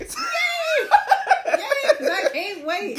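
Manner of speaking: high, loud laughing yay; excited laughing cheer. Real, not acted. Mock excitement at being asked.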